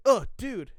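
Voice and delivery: surprised exclamation. Retold or imitated. Acting friend's reaction.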